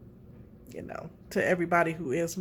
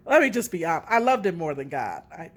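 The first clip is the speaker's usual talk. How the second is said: ordinary delivery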